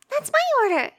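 high, loud delighted exclamation; excited. Acted. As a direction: burst out in happy surprise. Finds they share an order.

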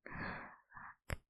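ordinary talk

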